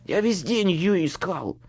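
a touch of hissing exasperation; voiced man's hiss, only hinted; hissed retort. A narrator's reading. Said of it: "шипел мужской голос".

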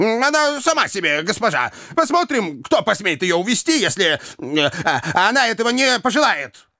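high, halting bluster; cocky eloper standing his ground; defiant rogue. A narrator's reading. Jingle defies them.

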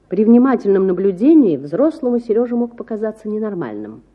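ordinary delivery, nothing marked

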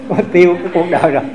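quick line through real laughter; laughing. Real, not acted. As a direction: chuckle the words out fast. Cười sau chuyện bình bát. (vừa cười vừa nói nhanh)

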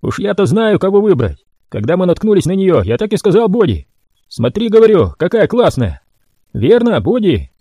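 high, bragging brute; boastful mutant Royal. A narrator's reading. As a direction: crow about the catch.

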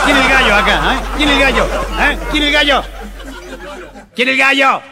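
shouting high and loud, spoiling for a fight; violent drunk challenge. Retold or imitated. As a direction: yell it at the whole bar, looking for trouble. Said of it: "se pone violento". (borracho violento gritando en el bar)

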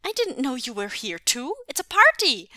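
high, loud and bubbling over; excited child. A narrator's reading. Son finds cousins also sleeping over, excited. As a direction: build to a gleeful high shout. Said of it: "Jingyi cried excitedly".

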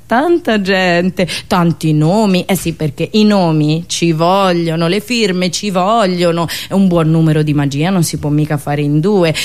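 ordinary talk, nothing marked

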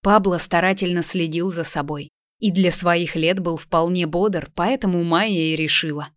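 ordinary delivery

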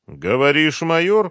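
stretched words, pitch climbing high; drawn-out question. A narrator's reading. Colonel ponders news that suspect is a major, drawling. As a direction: draw it out, mulling it over. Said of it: "протянул Демидов".

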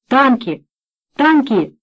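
shouted alarm from next room; distant alarmed shouting. A narrator's reading. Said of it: "доносился голос Марганака".

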